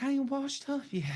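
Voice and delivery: high put-on voice of the singing girl; playful high falsetto quote; mock woman's voice. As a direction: pitch way up to play the girl.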